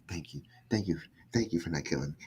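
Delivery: very low, hushed pleading; small, quiet voice of someone pleading; imitated begging. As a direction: beg softly, almost under your breath. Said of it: "he was begging her".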